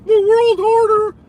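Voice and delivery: high, loud squeaky parody of the phrase; mock it in a shrill cartoon squeak; mocking squeal. Streamer mocking the caller's phrase in a loud high squeak.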